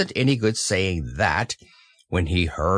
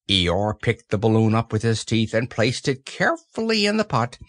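plain reading voice

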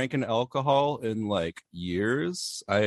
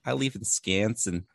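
put-on Wisconsin accent; 5 dB quieter, slower than usual. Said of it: "people there actually talk like that".

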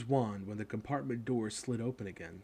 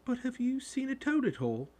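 very high, plaintive question; upset boy pleading for help; tearful; 14 semitones higher, 4 dB louder than usual. A narrator's reading. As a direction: ask as if close to tears. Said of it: "He looked tearful".

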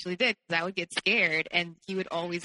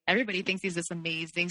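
ordinary delivery

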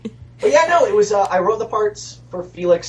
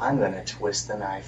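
lower, softer threat; low menacing voice; 9 semitones lower, 10 dB quieter than usual. Shown as a demonstration. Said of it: "and then you go into the low".

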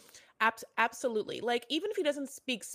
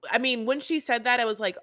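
usual speaking voice

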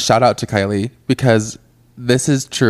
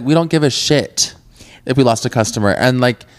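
usual speaking voice

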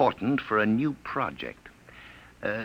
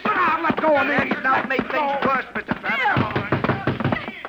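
struggling shout; 14 semitones higher, 4 dB louder than usual. Acted. Struggle, villain grabbed by Travis.

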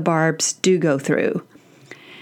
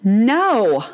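put-on curt sarcastic teen no. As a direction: snap it back sarcastically. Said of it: "she says in a real sarcastic manner".

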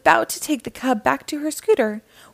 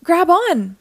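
high, loud rescue call; shouting; 7 semitones higher, 6 dB louder than usual. A narrator's reading. Mister Grouper tossing yarn to the ranger, urgent shout. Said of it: "Mister Grouper shouted".

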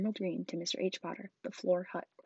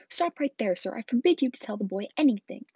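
raised, forceful forbidding voice; loud command; 4 semitones higher, 9 dB louder than usual. A narrator's reading. Uncle forbids the giant to tell, commanding. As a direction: order him to stop at once. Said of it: "he commanded".

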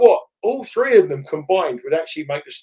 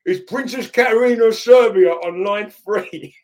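put-on loud, high, slow London accent announcement; common London accent; 7 semitones higher, 6 dB louder, slower than usual. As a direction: bellow it like a big London lad. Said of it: "like, a, quite a common, like, London accent".

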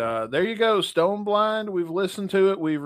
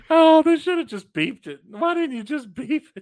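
laughing; 9 semitones higher, 6 dB louder than usual. Real, not acted. Laughing, mock outrage.

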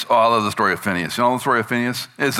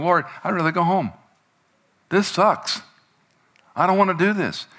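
mock complaining; 11 semitones higher than usual. Retold complaint during chronic pain.

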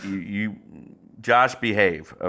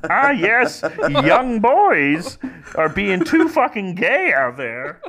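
mock Jordan Peterson lecturing Milo; hammed-up pundit voice, higher; Jordan Peterson impression; 9 semitones higher than usual. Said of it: "Doctor Peterson".

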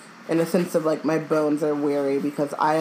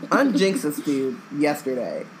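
ordinary delivery, nothing marked

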